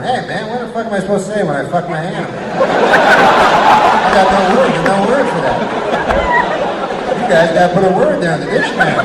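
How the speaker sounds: loud, high mock-desperate caller; caller to dictionary office. Man phoning the dictionary office, loud and pleading. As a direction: beg the dictionary office for a word.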